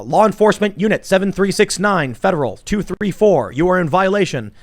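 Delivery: stiff robotic readout. Retold or imitated. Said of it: "the other robot runs up and goes".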